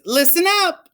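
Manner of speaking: put-on high, commanding child voice; little girl bossing baby brother, high; bossy little teacher. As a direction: order the class to attention.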